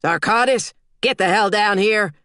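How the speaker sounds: loud drill-sergeant call; barking order. A narrator's reading. Sergeant yells for Zarkatis to get down here, loud. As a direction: shout her down from across the range.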